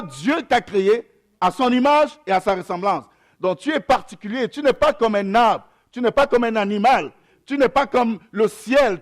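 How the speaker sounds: ordinary talk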